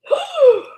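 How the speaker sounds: high sharp theatrical gasp; big mock gasp. Reader gasping at the bear for listeners. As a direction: gasp up high in fake shock.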